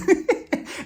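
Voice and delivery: high breathy laugh; delighted high laugh. Real, not acted. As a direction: cackle at the callback. Laughing at New York.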